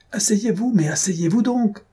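high-pitched urgent call; alarmed cry. A narrator's reading. Husband to wife standing up in the boat, high alarmed cry. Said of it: "Lasolée cria".

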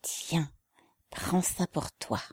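soft whisper under the breath. A narrator's reading. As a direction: whisper it so the officer cannot hear.

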